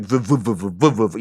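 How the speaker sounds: pulsing "vuh-vuh" fan noise; rhythmic mouth-made fan whir; imitated metal fan. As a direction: chug like an old electric fan. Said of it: "World War Two fan that's like".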